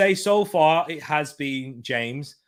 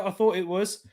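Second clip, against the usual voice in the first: ordinary talk, nothing marked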